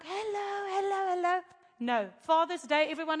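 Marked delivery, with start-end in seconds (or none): 0.0-1.4 singled-out tourist getting high-pitched hellos; imagined eager greeting. Acted. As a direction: greet the newcomer in a high, put-on voice. Imagines churchgoers eagerly greeting her.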